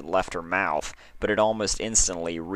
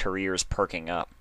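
plain reading voice

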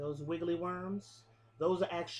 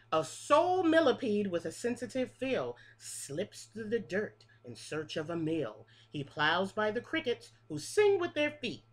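lilting picture-book read-aloud, each line rising then falling; reading to children; 5 semitones higher, 5 dB louder than usual. Acted. Teacher reading a bug rhyme book to her class, sing-song. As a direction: read the rhyme like story time for little kids.

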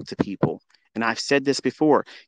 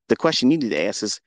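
ordinary talk, nothing marked

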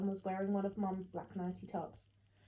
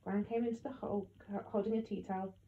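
ordinary delivery

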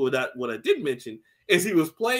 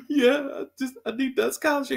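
mock crying; 8 semitones higher than usual.

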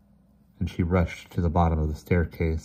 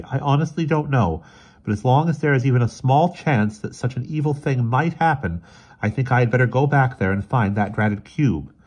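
raised, emphatic character voice; resolute Emerson, troubled; 7 semitones higher, 6 dB louder than usual. Acted. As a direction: insist on going back despite doubt.

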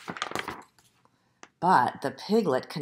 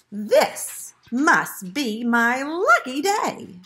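slow, drawn-out, higher exclamation; mild shout; 5 semitones higher, 4 dB louder, much slower than usual. A narrator's reading. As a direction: exult slowly, like a fox in luck. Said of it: "the fox shouted".

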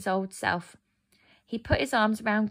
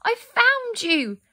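put-on high, slow, joyful girl's cry; a girl overjoyed to find her lost friend; 6 dB louder, slower than usual. A narrator's reading. Gerda finds Kay in the ice hall, high joyful cry. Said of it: "Gerda gave a cry of joy".

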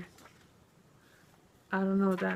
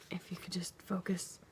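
unvoiced whisper to the camera; whispered plea; 13 dB quieter than usual. Real, not acted. Urging the camera to focus, whispered. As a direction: hiss it under your breath at the lens.

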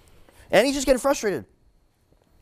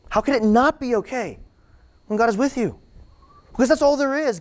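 high passionate plea; 7 semitones higher than usual. Acted. Repeated, raised.

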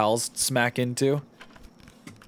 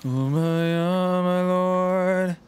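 high, very slow drawn-out oh my lord; meme voice; 7 semitones higher, much slower than usual. Pretend. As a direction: stretch it out like the meme.

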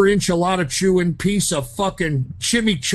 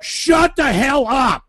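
slow angry yell; 7 semitones higher, slower than usual. Acted. Caller yelling at clerk.

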